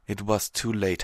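ordinary delivery

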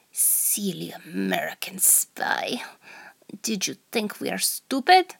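whispered Russian spy interrogator; hammed-up Russian accent, hushed start; over-the-top Russian accent. Interrogator taunting the captured American spy in a Russian accent. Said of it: "over-the-top Russian accent".

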